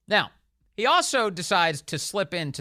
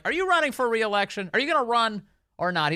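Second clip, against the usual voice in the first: a raised, pressing call of reporters' questions; mock reporter shout; 7 semitones higher, 4 dB louder than usual. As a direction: fire the question like a reporter in a scrum. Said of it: "they shout out things like".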